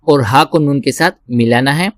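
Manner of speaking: ordinary delivery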